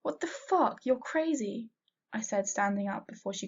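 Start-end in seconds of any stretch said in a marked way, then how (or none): 0.0-1.7 high, disbelieving cry; shocked outburst. A narrator's reading. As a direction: blurt it out in shock.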